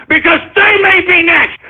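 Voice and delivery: builds to a high shout; warning the other countries, shouting; rising angry shout. Real, not acted. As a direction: threaten the world with a yell. Ranting about rival countries.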